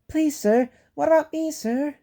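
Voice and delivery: high, eager little engine pleading; anxious. A narrator's reading. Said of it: "asked Peter Sam anxiously".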